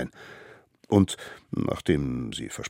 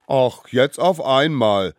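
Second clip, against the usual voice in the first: loud, higher indignant jeer; quarrelsome retort; 9 semitones higher, 10 dB louder, slower than usual. Acted. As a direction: throw it back scornfully. Little ones quarrel over the gift. (laut und zänkisch)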